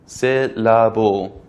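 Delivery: "Syllable" broken slowly into three separate beats. Shown as a demonstration. Teacher counting out three syllables for learners, slow split syllables. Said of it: "has three syllables".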